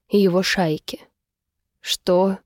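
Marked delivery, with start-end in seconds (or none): 1.8-2.4 understated grim question; gloomy. A narrator's reading. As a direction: ask it darkly, without raising the voice. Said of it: "лишь мрачно уточнил Калум". (мрачно, сдержанно)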